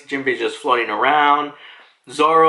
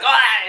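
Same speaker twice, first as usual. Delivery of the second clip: high strangled mock yelp; imitated choking cry; 7 semitones higher than usual. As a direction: cry out as if being choked. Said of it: "chokes him out".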